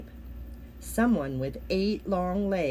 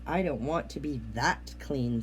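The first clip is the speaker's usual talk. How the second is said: frog voice; 6 semitones lower than usual. A narrator's reading. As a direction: croak it low like a frog.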